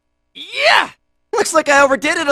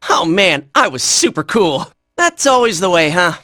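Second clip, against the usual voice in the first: much lower, relaxed voice; after-battle remark; 12 semitones lower than usual. Acted. Fighter sums up his own fight, low-pitched aside. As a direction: drop right down from the battle yelling.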